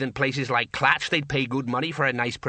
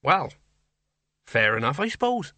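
uncertain; 2 semitones higher than usual. A narrator's reading. Sergeant accepts the odd explanation, uncertain. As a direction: give in doubtfully, with pauses. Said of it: "he said uncertainly".

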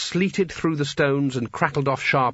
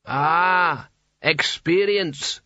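talking hat; 4 semitones higher, much slower than usual. A narrator's reading. Hat speaks.

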